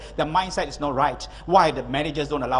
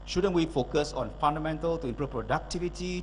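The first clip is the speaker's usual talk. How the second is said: ordinary talk, nothing marked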